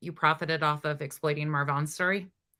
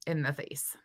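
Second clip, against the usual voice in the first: hushed aside; 3 semitones lower, 9 dB quieter than usual. Real, not acted. Trailing remark on her face.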